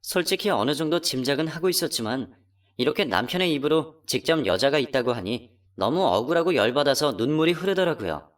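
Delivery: ordinary talk, nothing marked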